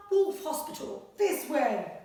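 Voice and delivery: animated picture-book read-aloud; reading to children. Acted. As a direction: read it like a bedtime adventure. Story reading continues.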